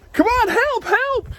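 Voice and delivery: very high, loud shouted help; frantic call for help. Acted. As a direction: yell across the water to the other boat. Frantic call to the other boat.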